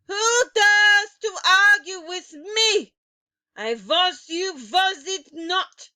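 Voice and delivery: high, loud threatening Grand High Witch; menacing witch. A narrator's reading. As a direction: loom over her with slow threat.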